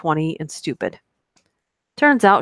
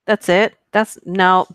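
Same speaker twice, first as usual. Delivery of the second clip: normal talking voice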